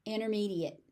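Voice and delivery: "Intermediate" as inner-mediate, no T; dropped T. Shown as a demonstration. Teacher modelling the normal word, silent T. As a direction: leave out the T in "Intermediate". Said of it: "The T just disappears".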